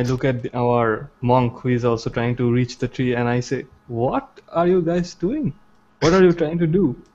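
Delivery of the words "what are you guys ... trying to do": high-pitched, baffled questioning; incredulous demand. Acted. Bewildered adventurer confronts the party over the tree fight, high and exasperated. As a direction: demand an explanation from your companions.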